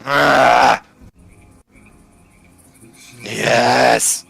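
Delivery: drawn-out, rasped creature noises; monster voice. Acted. Bone answering after his meal, monster voice. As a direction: stretch it out like a beast.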